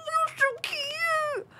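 very high, slow, teary squeal; overwhelmed by cuteness. Retold or imitated. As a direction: squeal it like a cooing pet owner. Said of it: "and you're just like".

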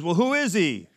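preacher pouncing on the reply; eager demand. Retold or imitated. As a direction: press him for the answer. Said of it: "And he goes".

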